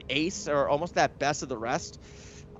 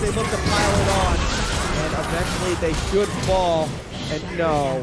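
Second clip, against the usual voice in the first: live play-by-play; 3 semitones higher, 3 dB louder than usual. Real, not acted. Caster calling the final fight as the base falls, excited play-by-play. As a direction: call the fight fast and loud as it unfolds.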